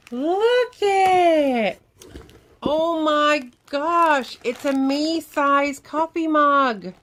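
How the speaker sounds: high, loud, slowed exclamations; thrilled. Real, not acted. Showing viewers her huge new mug, high loud and thrilled. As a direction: savor each word with delight.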